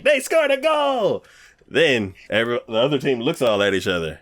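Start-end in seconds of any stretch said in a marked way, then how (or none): 0.0-1.2 announcer cheer. Retold or imitated. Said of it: "And it's like".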